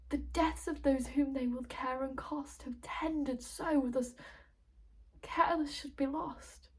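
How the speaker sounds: measured classical stage monologue, swelling from hushed reproach to impassioned lament; tragic verse declamation. Acted. Recites a tragic verse speech.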